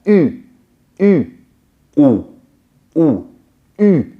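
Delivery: U said high and sharp with flat lips; U contrasted with Ou said through rounded lips; French u versus ou. Shown as a demonstration. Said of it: "The U on its own is a flatter".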